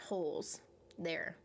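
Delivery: slow whisper of "holes there"; hushed trailing whisper. Real, not acted. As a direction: drop to a breathy aside.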